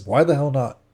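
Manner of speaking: exasperated challenge; agitated. A narrator's reading. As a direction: push him for an answer. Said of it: "I'm getting agitated now".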